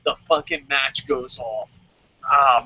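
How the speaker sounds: usual speaking voice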